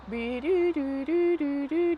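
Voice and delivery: slow, loud mock siren; sing-song alarm sound; siren noise. Raising a fireman alert with a playful siren.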